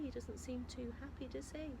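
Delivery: ordinary delivery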